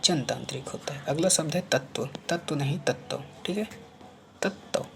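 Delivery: ordinary delivery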